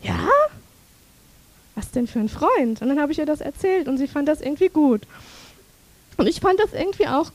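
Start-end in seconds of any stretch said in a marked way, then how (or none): none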